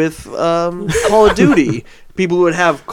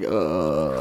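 low drowsy groan, barely awake; groggy sick groan; 13 semitones lower, 11 dB quieter than usual. Retold or imitated. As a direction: groan like you just woke up. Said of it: "that's what you're getting".

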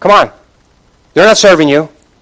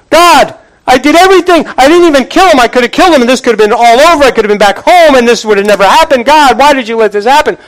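high-pitched pleading complaint to God; anguished protest; 7 semitones higher, faster than usual. Acted. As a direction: act out a wronged man wailing at heaven. Preacher acts David protesting to God.

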